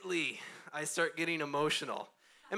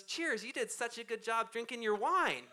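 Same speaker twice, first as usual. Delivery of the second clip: put-on higher, slow child's toast; cheeky little girl; 8 semitones higher than usual. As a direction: raise your cup like a little girl. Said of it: "she goes".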